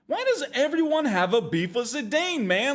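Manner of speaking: high-pitched incredulous whine; put-upon, high-voiced complaint; exasperated protest. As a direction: plead the case in mock exasperation. Mock complaint on the hero's behalf.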